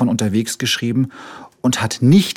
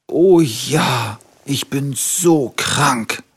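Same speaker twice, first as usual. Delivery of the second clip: sick little tiger; 4 semitones higher, much slower than usual. A narrator's reading. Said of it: "rief der kleine Tiger".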